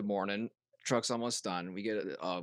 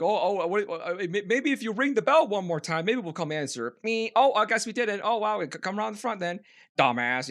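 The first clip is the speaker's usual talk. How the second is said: higher sarcastic mock voice; 8 semitones higher, 4 dB louder than usual. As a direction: sneer at the driver behind his back. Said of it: "was mocking him".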